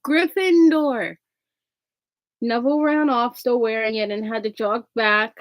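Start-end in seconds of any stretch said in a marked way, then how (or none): 0.0-1.2 higher, slow, drawn-out shout; hat's shout. A narrator's reading. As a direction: proclaim the house to the whole hall. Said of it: "Then it finally shouted".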